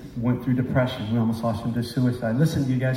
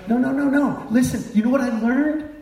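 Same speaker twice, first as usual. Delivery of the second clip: preaching at full force; 12 semitones higher than usual. Real, not acted. Preacher urging the congregation, at full force. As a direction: press the point home to the crowd.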